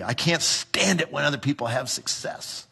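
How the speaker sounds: soft, sour grumble of envy; lightly voiced bitter complainer; mock envy.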